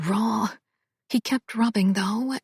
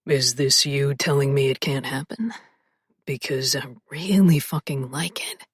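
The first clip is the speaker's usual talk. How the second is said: low, husky male voice, whispered; lower, intimate man's voice; male love interest voice; 7 semitones lower than usual. Acted. Male lead seductive.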